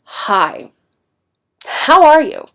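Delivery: curt mock-angry greeting, HOW pushed up hard; kind of angry. Shown as a demonstration. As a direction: greet them like you're annoyed. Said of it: "maybe they're kind of angry at you".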